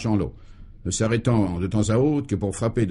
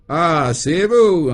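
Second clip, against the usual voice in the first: slow, loud, drawn-out mock surprise; high, swooping sarcastic question; 4 semitones higher, 6 dB louder, much slower than usual. A narrator's reading. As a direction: stretch the greeting with heavy sarcasm. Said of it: "interrogea ce dernier sarcastique".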